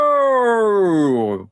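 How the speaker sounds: high, long-drawn commentator's goal call; Kenneth Wolfenholm. Acted. Gamer practising a commentator impression after scoring, drawn-out high call. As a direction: stretch out the name like an old football commentator. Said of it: "I'll need to practice a Kenneth Wolfenholm".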